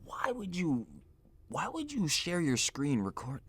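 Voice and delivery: very soft, baffled question; quiet disbelief. Real, not acted. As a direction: ask it under your breath, baffled. Same question after the outburst.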